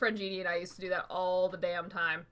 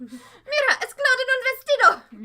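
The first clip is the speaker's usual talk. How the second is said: excited shout; 10 dB louder than usual. Retold or imitated. Excited quote in Spanish.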